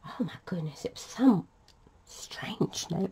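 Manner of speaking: soft, voiceless whisper; whispered aside. Real, not acted. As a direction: whisper it like a secret.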